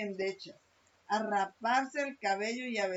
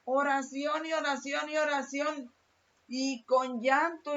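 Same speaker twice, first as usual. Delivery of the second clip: preaching; 4 semitones higher, 6 dB louder than usual. Acted. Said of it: "repeated emphatic sermon line".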